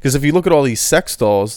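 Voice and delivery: usual speaking voice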